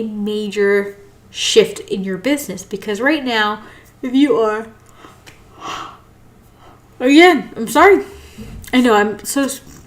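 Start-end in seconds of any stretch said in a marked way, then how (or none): 4.0-8.0 higher, louder words pushed out mid-yawn; yawning apology. Real, not acted. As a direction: apologize while a yawn takes over. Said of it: "So I'm gonna keep yawning".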